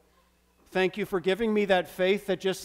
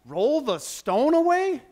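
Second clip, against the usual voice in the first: high, loud, slow incredulous echo; shocked disbelief; 10 semitones higher, 9 dB louder, slower than usual. Acted. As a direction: Martha balks at Jesus' command in shock. Martha shocked at Jesus' order.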